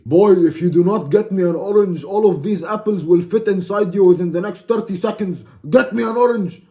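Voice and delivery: loud, high mock-furious tirade; hammed-up enraged shouting; angry threat. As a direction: yell the threat, climbing to a final shouted order. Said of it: "I'm getting angry".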